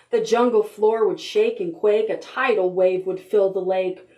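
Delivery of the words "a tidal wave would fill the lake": plain reading voice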